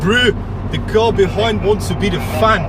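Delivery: bad South African accent; lightly put-on South African accent. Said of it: "That's my terrible attempt at a South African accent".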